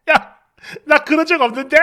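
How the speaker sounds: husband unable to hold in laughter; helpless giggling. Acted. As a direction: cackle through the denial. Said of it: "막 낄낄거리면서 웃음을 못 참아요".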